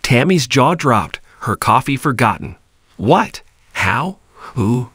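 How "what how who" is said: sudden rising What then quick questions; surprised disbelief. A narrator's reading. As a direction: blurt them out in shock. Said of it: "tumbled out in a flurry of disbelief and surprise".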